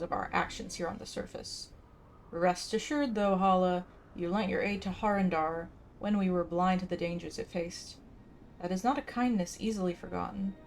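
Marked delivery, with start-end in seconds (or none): none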